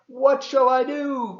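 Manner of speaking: high, loud desperate cry. Acted. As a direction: wail it out like a worried toad. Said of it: "cried Toad".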